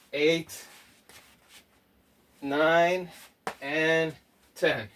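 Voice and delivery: steady, evenly spaced workout count; counting out reps. Real, not acted. Coach counting reps for viewers, measured count. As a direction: call each rep on the beat.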